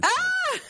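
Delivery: sudden very high yelp of glee; delighted shout. Real, not acted. Reacting to the Canadian-made kit with a high yelp. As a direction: burst out at the punchline.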